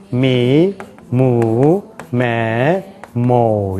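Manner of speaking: each syllable from "나" to "모" held long with a rising glide; English-style vocalization. Shown as a demonstration. Teacher drilling the syllable table, "나" to "모" drawn out evenly. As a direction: chant "나, 니, 누" onward as long separate syllables. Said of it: "이렇게 되는 거예요".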